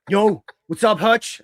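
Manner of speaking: loud fired-up coworker hello; hyped Moxley greeting. Retold or imitated. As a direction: greet him like an amped-up wrestler.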